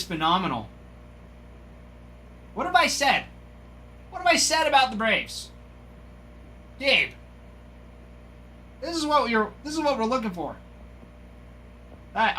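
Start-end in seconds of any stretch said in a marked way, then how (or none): 2.5-10.0 excited exclaim. Real, not acted. Collector thrilled at pulling a Braves card, high excited exclaim. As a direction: pitch jumps way up, fast and thrilled.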